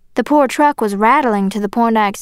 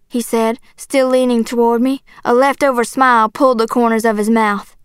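ordinary delivery

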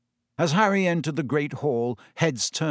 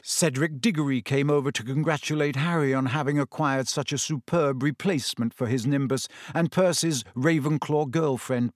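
ordinary delivery

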